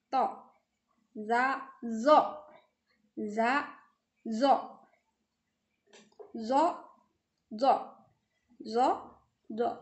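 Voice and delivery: teacher drilling ta to, za zo; heavy letter demo. Shown as a demonstration. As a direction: round out "zo" heavily. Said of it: "Itu pengucapan yang harus bisa dibedakan".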